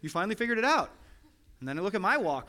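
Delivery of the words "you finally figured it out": mock duh. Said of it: "I can look at my kid and be like".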